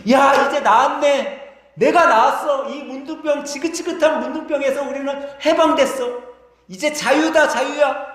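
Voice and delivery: overjoyed healed leper. Acted. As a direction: shout out the freedom of healing. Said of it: "다들 기뻐하고 즐거워하는 거예요". (병이 나아 기뻐 외치는 목소리)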